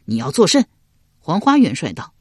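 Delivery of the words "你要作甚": startled, high-pitched demand; alarmed question. A narrator's reading. General suddenly seized by the marshal, alarmed question. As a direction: ask it in shock, pitch jumping up. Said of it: "高黑惊问道".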